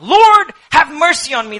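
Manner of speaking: high, loud plea for mercy; crying out. Acted. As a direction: call out desperately over a crowd. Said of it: "he starts crying out".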